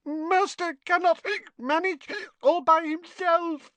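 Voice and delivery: high, tipsy house elf; a wobbly, squeaky little elf. A narrator's reading. Winky continues pleading.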